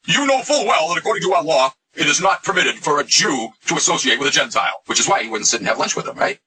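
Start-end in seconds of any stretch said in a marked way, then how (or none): 0.1-4.8 higher declaiming quote voice; Peter. Acted. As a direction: declaim the rule in a raised pitch. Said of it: "he said in the book of Acts".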